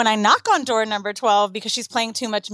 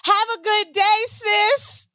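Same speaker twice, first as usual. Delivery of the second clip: high, slow mocking imitation of a neighbor; put-on slangy neighbor; 8 semitones higher, much slower than usual. Departing neighbor calls her sis, put-on slangy imitation. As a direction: draw it out high with fake sisterly familiarity. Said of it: "she was putting on for me".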